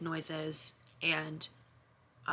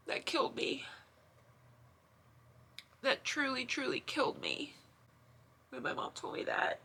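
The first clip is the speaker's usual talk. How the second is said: higher, softer, choked whisper through tears; crying; 7 semitones higher, 5 dB quieter than usual. Real, not acted. Sister recalling what her mom told her, crying. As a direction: barely get the words out while crying. Crying over mother's report.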